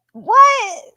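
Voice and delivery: sudden high, loud shocked "What?"; a stunned yelp of disbelief. Real, not acted. Stunned by Daddy's little addict message, a shocked high yelp. Reacting to a creepy chat message.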